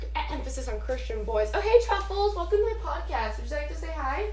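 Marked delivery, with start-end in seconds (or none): 1.5-4.2 high, soft coo to a pet. Real, not acted. Greeting her pet Truffles, high soft coo. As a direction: coo sweetly at the dog.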